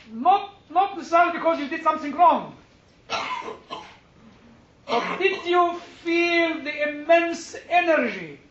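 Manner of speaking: impassioned sermon delivery; preaching. Acted. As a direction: preach it to the congregation. Preaching at full force.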